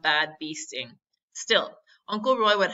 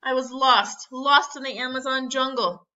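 high, raised narration of alarm; panicked; 6 semitones higher, 5 dB louder than usual. A narrator's reading. Boy realizing he is lost, acted panic. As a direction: let panic push the voice up.

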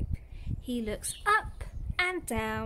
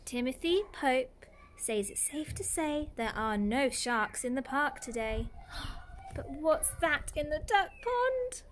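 ordinary delivery

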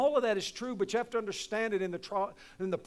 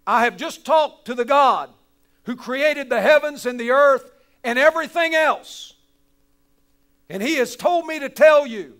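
loud, proclaiming angel's message; booming angel; 5 semitones higher, 10 dB louder than usual. Acted. Angel speaking down to the people, loud proclamation. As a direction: announce it from heaven with full force. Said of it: "This angel comes down from heaven and says".

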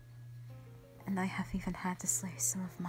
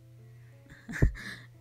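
short, wordless chuckle; a brief laugh on the breath; breathy laugh; 3 dB louder than usual. Acted. Sister remembering the hatching, breathy laugh.

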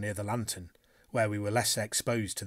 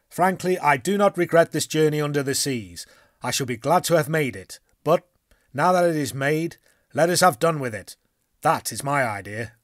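louder, higher, hearty sailor voice; a blunt harpooner, eager to plot; bluff Canadian harpooner; 4 semitones higher, 8 dB louder than usual. Acted. Harpooner urges his companions to talk, hearty and loud.

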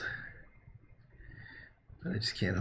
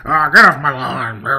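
put-on loud, cranky old-man bark; grumpy old codger yelling off his lawn; 11 dB louder than usual. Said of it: "being a grumpy old man".

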